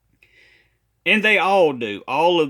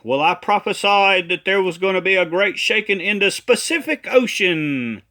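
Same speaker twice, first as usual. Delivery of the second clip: mock insistent prophet, higher, "Pacific" as spuh-SIF-ic; prophet's excuse, mispronouncing; 4 semitones higher, 3 dB louder than usual. Critic mimics the prophet's excuse, mock insistent with "Pacific" as spuh-SIF-ic. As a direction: imitate the defence, adding an s to "Pacific". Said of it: "he's gonna try to say".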